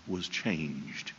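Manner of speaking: ordinary delivery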